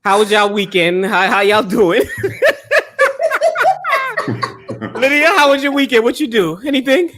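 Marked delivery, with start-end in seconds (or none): 2.1-4.9 a long, high burst of laughter. Real, not acted. Host laughing at greetings.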